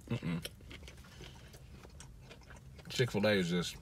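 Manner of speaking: talking with food in mouth; muffled mid-chew speech; chewing while talking. Real, not acted. Seen 0: bit sandwich before; hand at mouth, still chewing early on.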